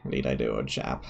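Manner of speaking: a low, mock-British old chap; posh British accent. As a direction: drop low and play the stuffy British gentleman. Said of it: "Don't know what accent you mean".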